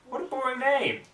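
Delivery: high, disdainful scoff. Acted. Pony mocking the bird's dull species name, high scoff.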